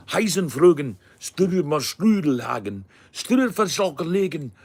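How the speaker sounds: loud, high gibberish in a German accent; mock fluent German. Shown as a demonstration. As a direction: rattle out fake German at full voice. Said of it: "try and do the approximation of it".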